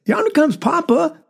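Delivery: high child's call, lightly voiced; a hint of a small boy's shout; little brother calling out. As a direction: call out like an excited little boy. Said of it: "called out and said".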